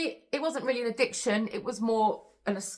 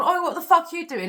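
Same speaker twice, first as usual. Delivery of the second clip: higher, louder mock shout at the attacker; retold shout; 8 semitones higher, 6 dB louder than usual. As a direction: call out like a stranger breaking up a fight. Stranger stops the attack.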